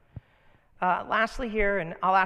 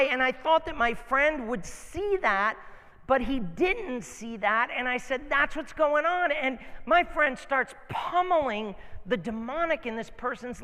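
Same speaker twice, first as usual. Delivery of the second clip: loud, higher, forceful sermon delivery; impassioned preaching; 7 semitones higher, 6 dB louder than usual. Real, not acted. Preacher telling church God's gifts still work, impassioned.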